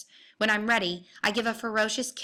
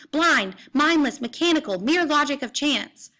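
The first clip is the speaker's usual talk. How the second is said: bellowing; 5 semitones higher, 4 dB louder than usual. A narrator's reading. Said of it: "I bellow back".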